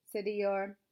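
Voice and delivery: ordinary delivery, nothing marked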